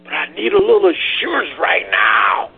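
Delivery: anguished prayer to God; pleading cry. Acted. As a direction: voice cracking, crying out to the Father. Voicing Jesus crying out to God.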